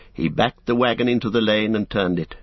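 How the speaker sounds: usual speaking voice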